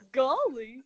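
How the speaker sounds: a hint of a goofy cartoon voice, through a laugh; lightly done female Goofy. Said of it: "female Goofy".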